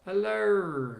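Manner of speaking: hammed-up Australian accent, high and drawn out. Streamer greeting a viewer from Melbourne, mock Australian accent. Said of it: "That's my best Australian accent".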